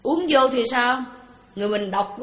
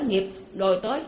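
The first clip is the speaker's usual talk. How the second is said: normal talking voice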